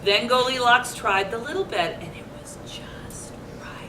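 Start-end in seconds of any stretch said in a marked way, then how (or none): 2.0-3.9 hushed storybook whisper. A narrator's reading. Reading to children, whispering as Goldilocks sleeps. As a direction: breathe it out, no voice.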